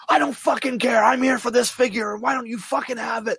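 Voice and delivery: imitating furious customer. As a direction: play the raging adult, squealing demands. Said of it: "losing his fucking mind".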